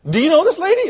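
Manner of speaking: high, loud astonished question; can't believe it, pitch leaping up; incredulous cashier. Retold or imitated. Cashier reacts in disbelief.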